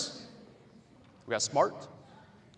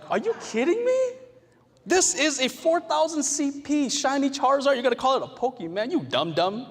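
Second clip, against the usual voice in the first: much higher, louder put-on voice, playfully scornful; mock-indignant player scolding a friend, faster on the question; outraged gamer; 11 semitones higher, 5 dB louder than usual. Teacher voicing a gamer scolding a friend, mock outraged.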